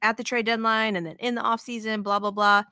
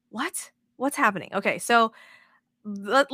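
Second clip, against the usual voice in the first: ordinary talk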